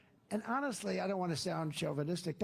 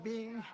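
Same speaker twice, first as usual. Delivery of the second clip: imitated lift sound; 6 semitones higher than usual. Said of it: "He's going like".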